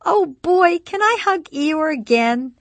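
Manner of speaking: very high, loud, drawn-out delight; thrilled baby-talk. A narrator's reading. Ginny answers the baby offering his toy, high and thrilled. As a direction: exclaim with big, high-pitched excitement for a toddler. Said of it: "shining with the same intensity".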